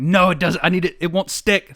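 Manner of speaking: retelling a frantic scramble to fix a leak, mock panicked. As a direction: play it as a half-joking panic, pitch up. Retold panic, pipe won't fit.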